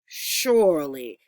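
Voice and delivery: usual speaking voice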